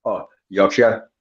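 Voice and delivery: Yorkshire slowly with a short shuh end. Shown as a demonstration. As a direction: carefully say Yorkshire like a Brit. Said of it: "say Yorkshire".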